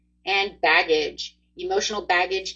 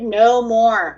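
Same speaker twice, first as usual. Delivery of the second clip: ordinary delivery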